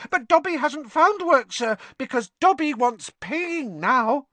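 high, squeaky elf voice; squeaky house elf. A narrator's reading. Dobby telling Harry of his search for work, squeaky. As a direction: squeak it out earnestly.

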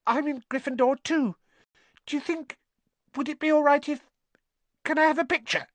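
put-on very high, tentative boy's voice; hopeful schoolboy. A narrator's reading. Colin shyly asking Harry for a picture, hopeful schoolboy. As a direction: ask hopefully in a high little voice. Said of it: "raising the camera hopefully".

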